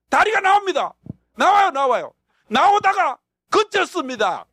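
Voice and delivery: high, loud, thrilled report of the leg coming; excited testimony. Retold or imitated. Pastor reliving the miracle to the congregation, thrilled and high. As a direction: ring out each step as it happens.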